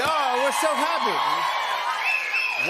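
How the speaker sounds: drawn-out excited cry; gushing delight. Real, not acted. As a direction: gush over it slowly.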